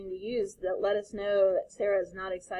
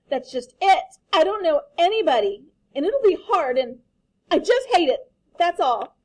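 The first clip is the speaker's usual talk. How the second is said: put-on much higher, loud complaining child; upset child; 7 semitones higher, 10 dB louder than usual. Daughter complaining to her father, high loud upset child. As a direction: wail it like a miserable kid.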